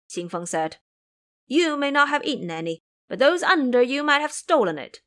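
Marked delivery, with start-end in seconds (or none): 1.5-5.0 higher, louder accusation; accusing boy. Acted. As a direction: point the finger. Qing Feng accuses.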